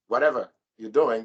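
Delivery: ordinary talk, nothing marked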